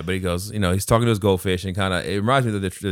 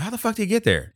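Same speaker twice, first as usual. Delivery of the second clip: mock disbelief; 13 semitones higher, faster than usual. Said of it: "I was thinking the same thing".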